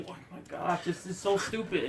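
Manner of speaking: breathy whisper, no voice; whispered mock complaint. Donatello flying around, whispered gripe. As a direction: whisper it like a fed-up aside. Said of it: "Donatello flying around like".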